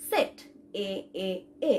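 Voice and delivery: সেট, then its এ as separate 'eh' sounds; model pronunciation. Shown as a demonstration. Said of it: "ই তালিং এর উচ্চারণটা হবে". (সেট বলে আলাদা করে এ, এ, এ)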